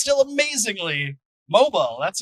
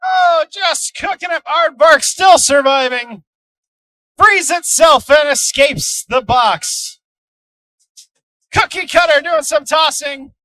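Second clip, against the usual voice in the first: excited, loud fight commentary; live play-by-play; 5 semitones higher, 6 dB louder than usual. Acted. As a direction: call the action as it happens.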